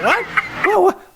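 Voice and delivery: high, punchy fake outrage; mock indignant what. Host teasing Bengals fans, high mock outrage. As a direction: demand an answer, mock baffled. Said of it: "We have to say it like that".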